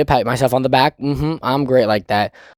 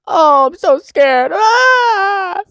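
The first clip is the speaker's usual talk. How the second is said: high, slow, whimpering mock sob; hammed-up fake crying, much higher; mock crying whine; 6 dB louder, much slower than usual. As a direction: whine it like a scared crybaby, hammed up. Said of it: "But he's".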